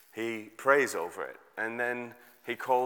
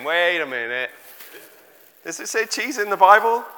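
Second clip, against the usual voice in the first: higher, louder mock-incredulous query; objecting listener; 6 semitones higher, 6 dB louder than usual. Congregation challenges the preacher over cheese in the Bible, mock incredulous. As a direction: play a doubter interrupting, pitch climbing on the question. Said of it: "You're saying".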